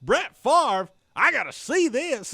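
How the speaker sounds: high, loud excited holler; excited player impression. Host quoting a football player, excited holler. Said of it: "Moss is prancing around, and he goes".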